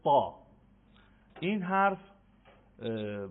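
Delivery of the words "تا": تا said loud with a heavy emphatic ط; loud emphatic ta demo. Shown as a demonstration. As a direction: punch out تا as a thick Arabic ط. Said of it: "حرف ششم حرف".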